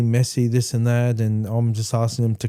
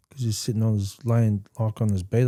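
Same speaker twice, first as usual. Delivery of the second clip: usual speaking voice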